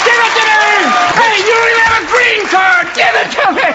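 very high, screamed tantrum; truthful outburst. Acted. Losing nominee being really truthful about the award, screaming tantrum. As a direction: scream and demand it like a sore loser. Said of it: "really truthful and go".

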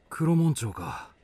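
ordinary talk, nothing marked

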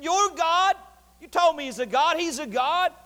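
high-pitched taunt; mocking. Elijah goading the prophets about their god, mocking. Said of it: "He mocked and said".

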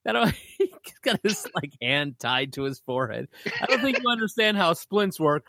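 high, laughing and incredulous; breaking up over the absurd injury; laughing disbelief. Real, not acted.

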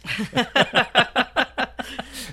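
high loud burst of laughter; big laugh. Real, not acted. Laugh after joke.

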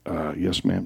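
low, hushed answer; soft, hesitant murmur to a teacher; meek student reply. Retold or imitated. Student caught writing a note answers the teacher, meek and quiet.

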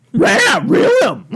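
high, loud cartoon dog voice; garbled talking-dog impression; Scooby. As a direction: yelp the words like a cartoon dog.

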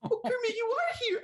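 very high puppet-diva falsetto; high diva impression. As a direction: greet a partner in a squealing diva voice. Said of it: "during my impression".

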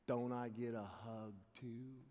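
low, slow, quiet plea; imitating a tough guy gone meek; sheepish request. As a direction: ask it slowly and softly, almost embarrassed. Tough man sheepishly wants hug.

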